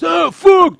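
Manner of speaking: very high, loud mock shout; pained yelp. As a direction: yell it out like you just got hit. Retold cry on being hit.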